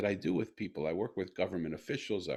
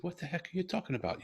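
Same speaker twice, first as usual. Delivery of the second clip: much higher, fast, astonished; stunned disbelief; 10 semitones higher, 3 dB quieter, faster than usual. Retold or imitated. Father hearing his daughter speak, astonished high blurt. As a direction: blurt it out in amazement.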